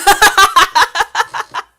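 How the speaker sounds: high, loud burst of laughter; real high cackling laugh. Real, not acted. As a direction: crack up after the silly voice. Breaks up after the high voice.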